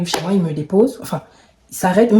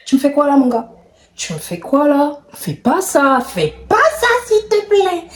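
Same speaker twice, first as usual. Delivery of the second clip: rising higher into a pleading squeak; anxious protest; 8 semitones higher than usual. Retold or imitated. Reliving pushing the man away, in a high pleading voice. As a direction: plead with him to stop, climbing up.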